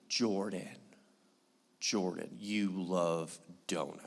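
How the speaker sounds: wife teasing husband about the dog, mock-sweet and soft; imitating his wife. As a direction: coax him like his wife, drawn out and low.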